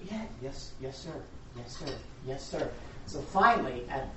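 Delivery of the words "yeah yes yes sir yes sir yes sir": softer, meek acknowledgement; patient reply. Retold or imitated. Girl to mocking lawyer, patient and soft. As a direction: keep answering quietly under taunts.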